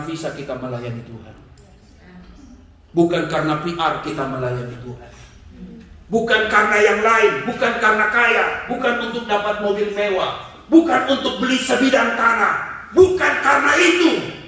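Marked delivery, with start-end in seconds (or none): 6.1-14.4 higher and louder, each line climbing; building sermon climax. Acted. Preacher warning servants, rising fervent preaching. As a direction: drive the list up to a peak.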